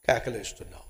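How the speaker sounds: ordinary talk, nothing marked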